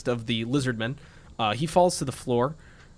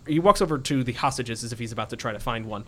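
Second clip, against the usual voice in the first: ordinary delivery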